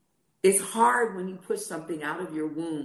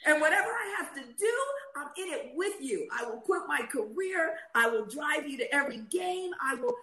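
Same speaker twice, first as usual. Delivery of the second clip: much higher, eager doting mother; doting mom; 9 semitones higher than usual. Retold or imitated. Mother answering her little son's NBA dream, doting and high. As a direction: promise the world to a little boy.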